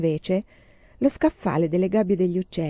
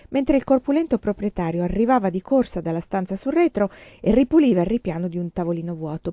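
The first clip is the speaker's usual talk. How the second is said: plain reading voice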